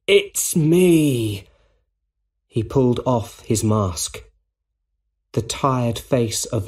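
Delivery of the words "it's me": pleading cry. A narrator's reading. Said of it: "the Green Goblin cried".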